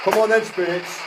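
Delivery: loud, high challenge; goading. Real, not acted. Ghost hunter challenging spirits to appear, goading. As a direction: call the spirits out.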